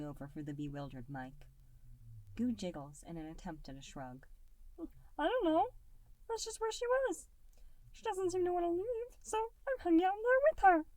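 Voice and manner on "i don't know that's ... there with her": put-on much higher, louder childlike creature voice; a tiny blob kid, sweet and distracted; little gelatinous Goo. A narrator's reading. Little Goo putting off dinner, high and bright. Goo's voice.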